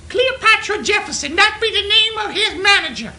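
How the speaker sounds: high, loud caricature voice; mock incredulous outburst. Caller mocking the manager's name, high loud caricature. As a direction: blare it out in derisive disbelief.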